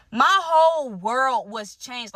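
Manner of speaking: slow, heavy words for effect; dramatic emphasis. Real, not acted. Telling her viewers the shock, slow and weighty. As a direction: let each word land slowly.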